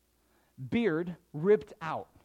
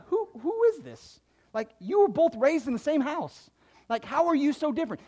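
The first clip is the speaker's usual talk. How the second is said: puzzled parent; 7 semitones higher than usual. Retold or imitated. Parent puzzled by two kids.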